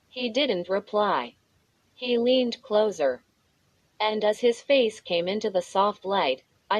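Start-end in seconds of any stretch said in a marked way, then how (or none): none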